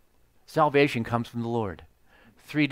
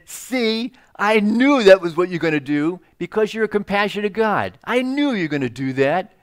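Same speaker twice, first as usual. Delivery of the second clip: whiny Jonah; 5 semitones higher, 5 dB louder than usual. Pretend. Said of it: "he became angry. And he said".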